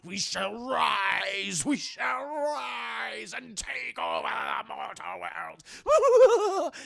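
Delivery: booming, drawn-out villain shout; villainous proclamation. Acted. Horrifying man addresses citizens of Halloween Town, villainous proclamation. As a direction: stretch each word like a rising evil overlord.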